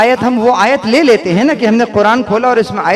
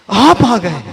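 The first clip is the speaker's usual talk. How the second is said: आप आ गए with honouring expression; expressive, deferential welcome; respectful welcome; slower than usual. Shown as a demonstration. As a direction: greet an esteemed guest with आप आ गए. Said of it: "अब इसमें expression डाल देते हैं".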